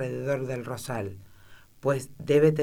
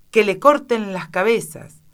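furious order; 7 semitones higher, 9 dB louder than usual. A narrator's reading. Queen orders execution.

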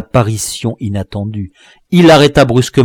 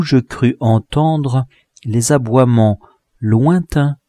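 usual speaking voice

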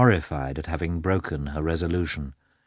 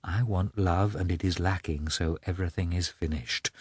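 much softer, hollow repetition; repeating to herself; 7 dB quieter than usual. A narrator's reading. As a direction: echo your own words quietly to yourself. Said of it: "She repeated her own words".

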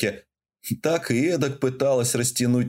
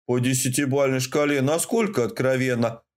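plain reading voice

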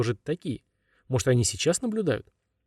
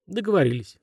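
sighing; 7 semitones higher than usual. A narrator's reading. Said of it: "вздохнула Анюта".

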